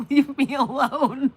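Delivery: said through laughter, slow; laughing it out. Real, not acted. Repeated while laughing.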